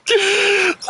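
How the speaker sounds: high shrieking laugh; evil chuckle. Real, not acted. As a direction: cackle like a villain. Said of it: "do my villain laugh".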